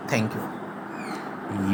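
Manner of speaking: ordinary talk, nothing marked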